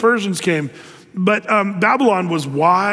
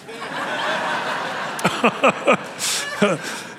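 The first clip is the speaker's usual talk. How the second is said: breathy laugh; 3 semitones higher, 4 dB quieter than usual. Real, not acted. Laughing at own punchline.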